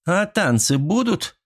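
high, drawn-out cheeky question; perky woman's voice. A narrator's reading. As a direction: pipe up playfully from the crowd. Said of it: "тот же самый задорный женский голос".

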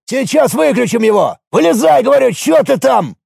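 high, insistent guard's command; impatient order. A narrator's reading. Guard to the stuck driver, impatiently ordering.

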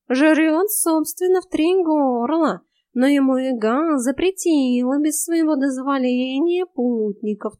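high, slow lazy cat voice; snooty bored cat. Acted. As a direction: drawl it like a smug cat. Cat voice in the story. (высокий ленивый кошачий голос)

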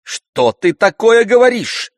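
high, loud indignant outburst. A narrator's reading. Mother shocked by daughter's words, indignantly. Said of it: "возмутилась мама".

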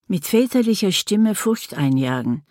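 usual speaking voice

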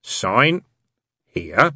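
long menacing pause between Sign and here; Sign, hold the silence, then here; terrible pause. A narrator's reading. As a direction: Hastur makes Crowley wait between words. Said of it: "leaving a terrible pause between the words".

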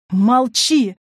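loud, abrupt order to hush; forceful outburst; angry command. A narrator's reading. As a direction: shut her up sharply. Enraged woman silencing her sister.

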